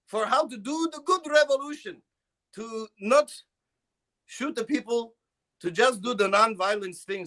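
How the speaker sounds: high, hammed-up Serbian accent; comic Serbian accent thanking a friend on a podcast. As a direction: play the grateful Serbian guy in a thick put-on accent. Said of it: "I'm the, like, the Serbian guy".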